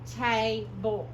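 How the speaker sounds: TABLE split as TAY, then BULL, long A; syllable by syllable. Shown as a demonstration. Teacher reads the coded word, TABLE split into TAY-BULL. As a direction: sound out TABLE slowly in two parts. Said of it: "long name".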